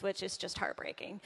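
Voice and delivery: hushed mock-tragic whisper; a sorry little whispered confession; whispered aside. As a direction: drop to a whisper like it hurts. Mock sorrow aside about Lakers.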